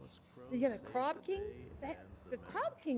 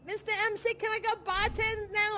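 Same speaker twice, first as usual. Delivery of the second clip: whiny plea; 7 semitones higher, 6 dB louder than usual. Retold or imitated. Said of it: "My drama's all like".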